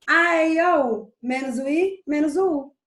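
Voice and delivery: "A, E, I, O, U" called out in beats, then "menos o I, menos o U"; rhyming mnemonic. Shown as a demonstration. Teacher sharing a memory trick, "A, E, I, O, U" in rhyming beats. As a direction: recite "A, E, I, O, U" as a rhythmic memory rhyme.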